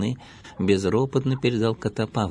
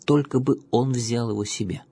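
usual speaking voice

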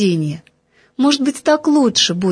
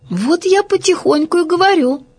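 lightly voiced child, higher pitch; a higher little-girl voice, defending herself; little girl explaining; 6 semitones higher than usual. A narrator's reading. Girl explains her silence to teacher, lightly childlike.

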